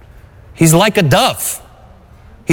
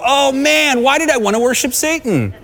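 higher, hammed-up whiny doubt; mock whine; 9 semitones higher than usual. Preacher mocking how Jesus did not answer temptation, hammed-up whine. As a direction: parody a silly, confused answer.

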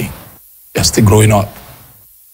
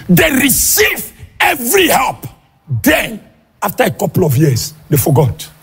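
fiery preaching; 8 semitones higher than usual. Acted. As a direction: preach it hard, half shouting to the congregation. Preaching at full force.

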